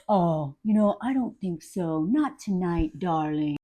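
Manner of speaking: low, soft mock suave man; jerk. Jerk brushing off the woman in the film, low mock-suave voice. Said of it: "this jerk is like".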